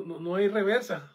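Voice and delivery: ordinary talk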